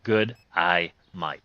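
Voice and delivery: slow, drawn-out Australian accent attempt; slow Australian try. Slow Australian attempt at his one learned line. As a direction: stretch the Australian "Good, I might" out slowly. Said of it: "one freaking line".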